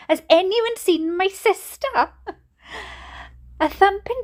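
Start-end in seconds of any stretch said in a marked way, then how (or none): 0.1-2.1 high-pitched dramatic wail; wailing mother. A narrator's reading. Mother appealing to the whole hospital, wailing. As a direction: wail the question to everyone. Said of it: "wailed my mother again".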